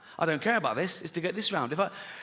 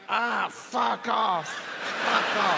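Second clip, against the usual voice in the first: acted yelling; 5 semitones higher, much slower than usual. Condemned man to the volunteers, mock yelling. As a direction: yell it out in mock agony. Acting the hanged man's reaction.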